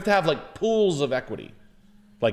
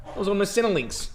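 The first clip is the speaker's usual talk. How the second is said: mock jobseeker excuse; 8 semitones higher than usual.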